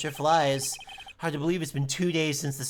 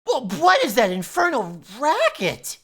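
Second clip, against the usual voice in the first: put-on grumpy old voice; 8 semitones higher, 6 dB louder than usual. Voicing grumpy old character.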